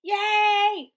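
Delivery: high, loud jubilant cheer. A narrator's reading. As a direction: burst out cheering. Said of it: "the crowd cheered".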